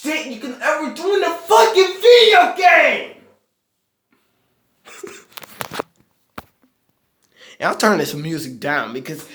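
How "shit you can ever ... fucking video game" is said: higher, louder ranting shout; angry shout. Real, not acted. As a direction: yell it out in frustration. Ranting about pointless score.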